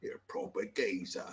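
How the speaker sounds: low, soft British geezer; mock British accent. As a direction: murmur it low in fake British. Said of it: "I wanna say in this accent".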